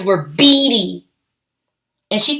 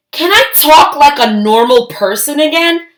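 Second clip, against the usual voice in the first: loud, higher shout; 5 semitones higher, 4 dB louder than usual. Acted. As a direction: shout it out in frustration. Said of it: "she shouted".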